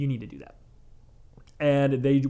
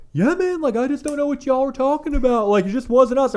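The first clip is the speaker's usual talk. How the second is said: high, fast put-on voice of a guy playing dumb; mock innocent stoner; 10 semitones higher, 4 dB louder than usual. Customer insisting to the tattoo crew it wasn't him, in a high put-on innocent voice. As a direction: squeak it out like you swear it wasn't you.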